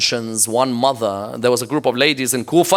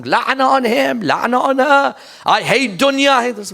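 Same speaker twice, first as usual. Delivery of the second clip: mock angry complaining; 11 semitones higher than usual. Mocking community members' complaining outburst.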